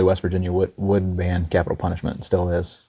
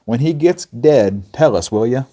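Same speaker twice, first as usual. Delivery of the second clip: mock yell, higher and louder, slow; playful shout to the doctor; 5 semitones higher, 5 dB louder, slower than usual. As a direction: call out across the crowd. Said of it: "Person yelled at the doctor".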